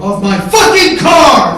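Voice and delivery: high, drawn-out, full-volume scream; enraged shout. Retold or imitated. Mike at the car window, screaming at his brother in rage. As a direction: stretch each word into a roar.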